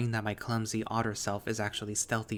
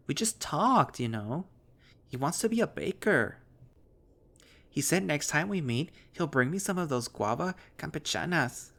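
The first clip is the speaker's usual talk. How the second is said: put-on lightly raised, dopey girlish voice; a touch of a childish young woman, chatty; Heather's voice; 6 semitones higher, 3 dB louder than usual. Girl gushing to her friend about a baker, lightly voiced.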